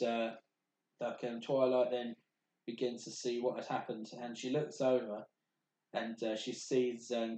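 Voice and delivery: normal talking voice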